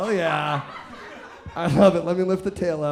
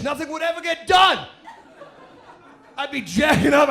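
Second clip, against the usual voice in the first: ordinary talk, nothing marked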